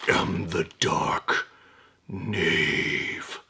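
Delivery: low, slow villain intro. Acted. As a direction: announce yourself like a dark villain. Opening villain self-introduction.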